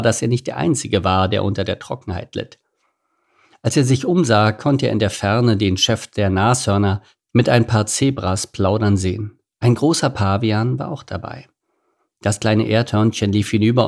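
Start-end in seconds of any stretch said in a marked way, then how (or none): none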